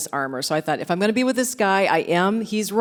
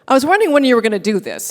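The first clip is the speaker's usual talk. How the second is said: fast, loud, high teasing; Bruce teasing; 6 semitones higher, 7 dB louder, much faster than usual. Retold or imitated. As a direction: rattle it off, amused. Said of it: "And he said".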